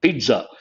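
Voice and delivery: pizza said peetsa, with ts; correct pizza. Shown as a demonstration. Teacher repeats for students: pizza with ts. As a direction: sound the T: pizza as peet-sa. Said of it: "spelling is P I T Z A".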